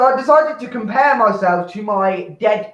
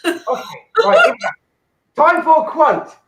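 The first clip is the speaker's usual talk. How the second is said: mock announcement; 9 semitones higher, 3 dB louder, faster than usual. Announcing segment.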